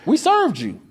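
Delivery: high, know-it-all insistence; mock smug agent. The agent insisting to him they served him, high and smug. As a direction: act the agent's smug certainty.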